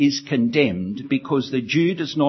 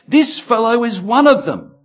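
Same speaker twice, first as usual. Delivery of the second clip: put-on accusing servant girl; 7 semitones higher, 7 dB louder than usual.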